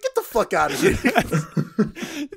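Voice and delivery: laughing too hard to get it out; laughing quote. Retold or imitated. Retelling the Punisher's line to a friend, laughing.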